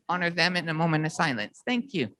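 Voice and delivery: ordinary delivery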